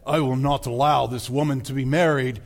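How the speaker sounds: low, stern royal decree; King Richard impression. The king halting a wedding, low and stern. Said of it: "King Richard says".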